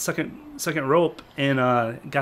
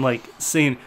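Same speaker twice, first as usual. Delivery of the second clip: normal talking voice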